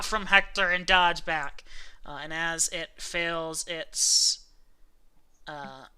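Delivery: ordinary delivery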